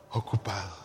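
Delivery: low, soft trailing excuse; acted excuse. Churchgoer telling the pastor why they didn't read, acted meek excuse. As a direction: drop low and quiet, mimicking the excuse-maker. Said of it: "¿Qué me va a decir usted?".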